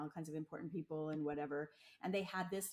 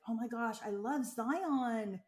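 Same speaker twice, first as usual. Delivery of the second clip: higher, louder tourist gush, Zion drawn out; gushing non-local; 4 semitones higher, 6 dB louder, slower than usual. Retold or imitated. Mocking a non-local who loves the park, gushing tourist. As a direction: imitate an out-of-towner gushing, stretching ZY-ON. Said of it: "someone who's not a local".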